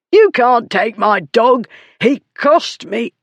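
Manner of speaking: high, slow, strained protest; bully's aggrieved shout; outraged protest. A narrator's reading. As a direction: break in angrily at a high pitch. Bully protests losing his dog.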